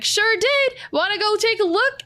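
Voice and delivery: high, breezy confirmation; cheery town crier Perry. Acted. Crier confirms the bagel news to the bard, high cheery voice. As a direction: gleefully invite her to go see. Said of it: "And Perry's like".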